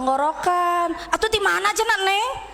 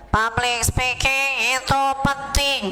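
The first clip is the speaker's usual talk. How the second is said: nasal, pinched-nose speech, slow; pinching nose; 2 semitones lower, slower than usual. Shown as a demonstration. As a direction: speak with the nose held shut. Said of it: "Ibu pami ieu kieu suantena teh".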